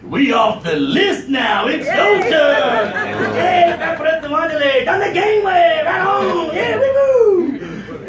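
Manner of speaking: high whooping celebration; hammed-up relieved shouting; mock jubilant passenger. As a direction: cheer with long drawn-out cries.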